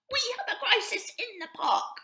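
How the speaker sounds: very high, loud little bird voice; a small sparrow with a big worry; chirpy sparrow. A narrator's reading. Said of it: "little Sue the sparrow chirped".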